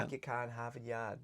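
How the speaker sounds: soft, dropped-r Boston accent. Shown as a demonstration. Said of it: "How do you do that Boston accent?".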